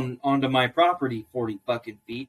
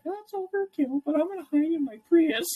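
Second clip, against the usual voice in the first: high, squeaky scared little voice; scared neighbor voice; 14 semitones higher, 3 dB quieter than usual. Retold or imitated. Mocking the scared neighbor, high and timid. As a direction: voice a frightened neighbor hiding away.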